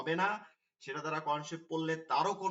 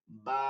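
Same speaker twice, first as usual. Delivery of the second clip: "বা" with the long aa held for one alif; stretched vowel; 4 semitones lower than usual. Shown as a demonstration. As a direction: hold out the vowel in "বা". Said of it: "এভাবে টেনে". (এক আলিফ টেনে "বা")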